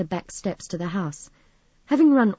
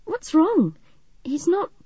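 high, anxious, breaking off unfinished; fearful, raised-pitch questioning; dreading; 10 semitones higher, 4 dB louder than usual. A narrator's reading. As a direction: hesitate before the unsayable. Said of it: "Somehow Midge couldn't say the word".

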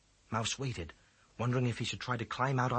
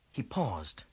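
usual speaking voice

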